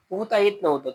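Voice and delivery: plain reading voice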